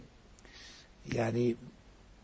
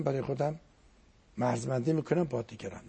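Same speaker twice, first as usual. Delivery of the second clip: ordinary delivery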